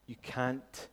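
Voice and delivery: ordinary delivery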